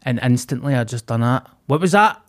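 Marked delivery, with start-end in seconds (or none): 1.7-2.2 a raised, challenging snap; sharp retort. Retold or imitated. Stranger snapping back at the speaker, raised challenge. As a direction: throw it back at him, high and loud.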